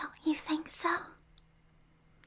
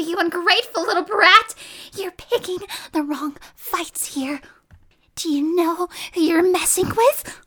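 wicked stepmother; 8 dB louder than usual. Acted. Sudden outburst after slapped hand.

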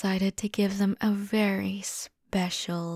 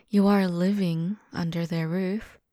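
ordinary talk

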